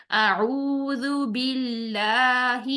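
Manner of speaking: اعوذ باللہ slow and measured; slow recitation. Shown as a demonstration. Teacher reciting the corrected phrase in full. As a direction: recite it with every letter correct. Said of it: "تعوذ اور تسمیہ کو ہی درست کرنا ہے".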